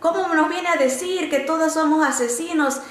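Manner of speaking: high-pitched mock indignation, not really shouted; retold angry outburst. Inmate protesting the teacher's words in Bible class, mock indignant. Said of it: "una de las encarceladas me empieza a gritar".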